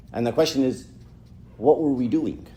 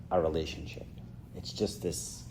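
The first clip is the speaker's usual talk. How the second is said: dropping to a hush; 7 semitones lower, 13 dB quieter than usual. Real, not acted. Voice dropping away.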